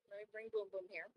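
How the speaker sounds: normal talking voice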